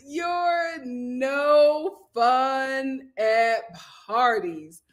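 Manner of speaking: very slow, stretched "you're no fun at parties"; slow mock scold. Real, not acted. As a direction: drag it out to tease. Drawn-out teasing repeat.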